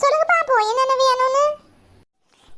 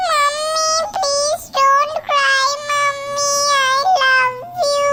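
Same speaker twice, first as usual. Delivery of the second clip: put-on high, slow small-child voice; little child; 4 semitones higher, much slower than usual. Small child begging crying mummy to stop, high and slow. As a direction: plead gently like a tiny kid.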